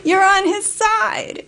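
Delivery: high amused retort; laughing insistence. Retold or imitated. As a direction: push it up, amused and emphatic. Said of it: "And I said".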